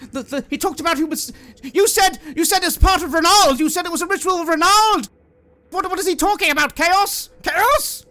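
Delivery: high, loud, frantic accusation; shrieking at the room in disbelief; shrill accusing woman. Acted.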